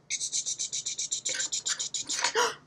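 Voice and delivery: long breathy gasp of amazement; a big mock gasp before the reveal. Anne produces the can opener.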